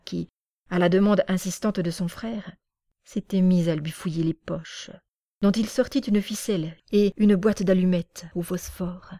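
ordinary delivery

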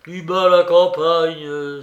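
put-on loud, slow drunken cry; drunken shout. A narrator's reading. Drunk Razoumikhine shouting at the feverish Raskolnikov. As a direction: bellow it out like a tipsy friend. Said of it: "se mit à crier".

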